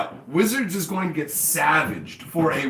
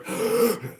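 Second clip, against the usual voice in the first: a higher, mock choking gasp; 6 semitones higher than usual. Company suffocating from its own plastic trees, mock gasp. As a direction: gasp for air like you're suffocating.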